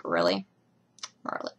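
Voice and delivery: low, flat, unimpressed Arthur; a hint of a deadpan king. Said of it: "and Arthur's like".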